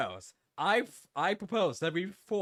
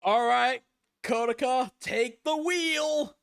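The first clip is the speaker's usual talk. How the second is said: loud slow command; 4 semitones higher, 7 dB louder, much slower than usual. Acted. Voicing character handing over.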